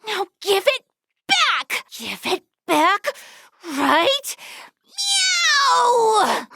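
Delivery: high, whiny cat voice that meows and begs; cat girl. Acted. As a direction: play a possessive cat demanding her friend back. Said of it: "I think I was a cat or something".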